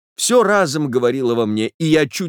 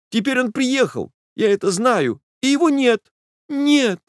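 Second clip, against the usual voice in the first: high, rising toward tears; agitated young woman; 7 semitones higher than usual. Acted. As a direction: voice breaking higher at the end. Nastenka's long speech, high pitch.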